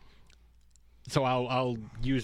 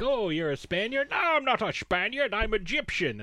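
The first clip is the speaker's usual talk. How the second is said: higher-pitched back-and-forth of two characters; mock film dialogue; 8 semitones higher than usual. Host retells the Spaniard mix-up to co-host, mock indignant exchange. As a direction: act out a protest at being misidentified, pitched up.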